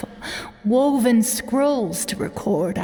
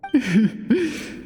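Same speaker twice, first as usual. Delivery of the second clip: a faint, tired chuckle; 2 semitones higher than usual. Acted. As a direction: chuckle gently through frailty. Elder laughs at question.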